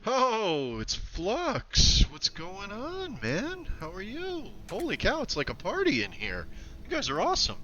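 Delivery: high, loud, delighted welcome; thrilled, pitch soaring; excited greeting. Real, not acted. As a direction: shout hello to a friend who just turned up. Greeting an arriving viewer.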